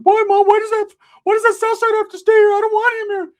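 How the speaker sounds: put-on high, loud whiny kid voice; whiny kid Ricky. Cousin Ricky whining to his mom.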